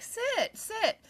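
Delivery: high, sing-song "sit, sit"; a high bossy imitation; squeaky mock command. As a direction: imitate the friends' chirpy order. Friends ordering her to sit.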